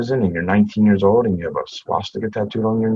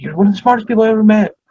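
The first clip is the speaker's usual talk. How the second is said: higher, louder, fast impassioned boss; Keith's voice; 14 semitones higher, 7 dB louder, faster than usual. Retold or imitated. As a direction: blurt the praise high and fast. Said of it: "his very Keith way".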